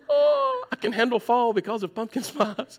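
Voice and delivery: high, loud gushing over pumpkin spice; gushing delight. Retold or imitated. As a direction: squeal it like a pumpkin spice fan in heaven. Mocking pumpkin spice fan.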